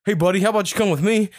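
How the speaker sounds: coach coaxing. Retold or imitated. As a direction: sweet-talk the dazed kid off the field like his coach. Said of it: "the coach was like".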